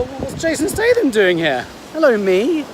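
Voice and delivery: amazed high exclamation, slow; surprised. Retold or imitated. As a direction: playfully gasp at a celebrity lookalike. Mistaking the instructor for Statham.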